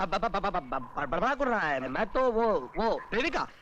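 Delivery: stuck repeating the first syllable; scrambling for an excuse; flustered stammer. Acted. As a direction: stumble over the words, caught out.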